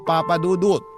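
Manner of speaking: normal talking voice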